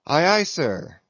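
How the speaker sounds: bright eager reply called out; cheerful cry. A narrator's reading. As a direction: sailor happily answering the captain. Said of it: "cheerfully cried little King Post".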